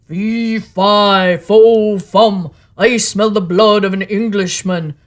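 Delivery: loud booming giant chant; ogre crying out. A narrator's reading. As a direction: boom it out, sniffing for blood. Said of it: "cried out the ogre".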